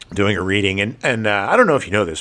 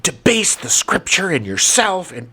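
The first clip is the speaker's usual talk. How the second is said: slow, high, imitating the nun's outraged scolding; mock outraged nun; 6 semitones higher, slower than usual. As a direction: act out a stern nun tearing into a child, drawn out. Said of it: "and screaming at me".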